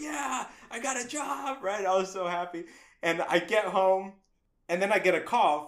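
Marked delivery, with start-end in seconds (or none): 0.0-1.6 high, stretched-out cry of joy; overjoyed cheer. Retold or imitated. New hire telling the whole street, overjoyed. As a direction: crow it down the street, slowly.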